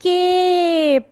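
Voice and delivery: केप with a long drawn ए; long vowel cape. Shown as a demonstration. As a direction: stretch केप out slowly. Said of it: "magic की add किया तो". (लंबा खींचकर केप)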